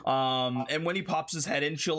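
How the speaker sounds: normal talking voice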